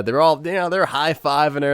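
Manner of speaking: usual speaking voice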